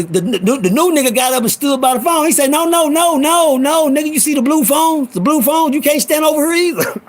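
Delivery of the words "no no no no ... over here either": high-pitched, insistent comic voice; mock alarm up in a high register; Showtime impression. Storyteller mimicking Showtime warning the newcomer off the phones, high and insistent.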